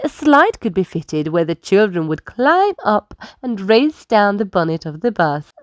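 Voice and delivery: usual speaking voice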